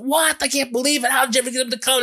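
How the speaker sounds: high, stunned disbelief; retold astonished gasp of a question; surprised sister. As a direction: squeal it out like the news is unbelievable. Sister hears Marv is coming.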